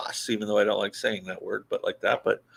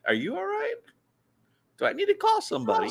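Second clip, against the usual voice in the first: high, fast pet voice; dog voice; 3 dB quieter than usual. Retold or imitated. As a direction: voice the dog, baffled. Voicing the dog's thoughts.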